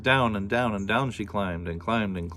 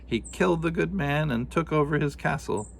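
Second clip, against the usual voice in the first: lightly voiced old woman, somewhat higher; a storyteller old woman, pitched up; old woman telling a tale; 7 semitones higher than usual.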